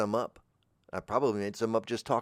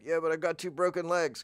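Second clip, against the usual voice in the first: high mock-pleading voice; protesting client; 7 semitones higher than usual. Client making excuses to trainer, mock pleading. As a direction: plead weakly, pitched up.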